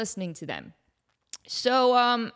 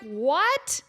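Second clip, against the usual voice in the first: mock shock; 8 semitones higher, 3 dB louder, much slower than usual. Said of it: "And I'm like".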